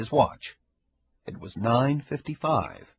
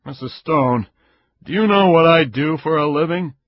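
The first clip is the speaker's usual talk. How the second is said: louder, pointed and hard; edge in his voice; 6 semitones higher, 9 dB louder than usual. A narrator's reading. Caperuzzi pressing Ida, voice with an edge. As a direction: lean on her with an edge. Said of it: "Caperuzzi's voice had an edge".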